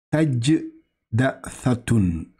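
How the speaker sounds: Tajdathatun drawn out syllable by syllable, very slow; slow Arabic reading. Shown as a demonstration. Teacher slowly reads Tajdathatun aloud for the learner. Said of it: "Très bien".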